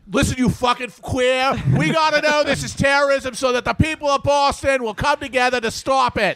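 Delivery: high, hammed-up Boston bluster; mock Boston tough guys. Bostonians berating the head FBI guy, hammed-up righteous outrage. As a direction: declaim it like an outraged Boston local.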